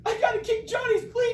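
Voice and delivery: loud high-pitched comic wail; hammed-up sobbing child; crying boy. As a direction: bawl it out like a tearful kid. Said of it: "Morris has been crying".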